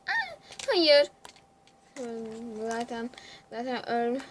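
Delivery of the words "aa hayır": high, loud shocked cry; surprised no. Real, not acted. Streamer suddenly killed, high shocked cry. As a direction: gasp it out as you get killed. Dies in the game.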